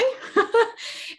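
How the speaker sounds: high amused laugh. Real, not acted. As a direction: burst out laughing at yourself. Laughs at her own reply.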